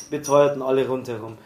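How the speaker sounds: ordinary delivery, nothing marked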